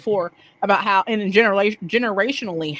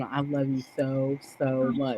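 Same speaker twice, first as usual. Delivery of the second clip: tender I love you; 3 semitones lower, 7 dB quieter, slower than usual. Retold or imitated. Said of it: "I just tell him".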